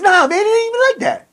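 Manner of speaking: squeaky high-pitched protest, backing down; mock scared high voice; high whiny denial. Scared inmate whining back at the challenger, high and squeaky.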